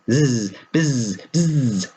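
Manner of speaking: a buzzy bee voice, rising; bumblebee buzz. Acted. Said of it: "said the bumblebee".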